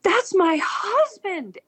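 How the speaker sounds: very high, slow, stunned cry; shocked gasp. Retold or imitated. Wife hearing of the accident, gasping in shock. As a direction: gasp out the realization in horror. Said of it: "gasps and goes".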